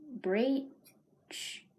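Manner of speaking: usual speaking voice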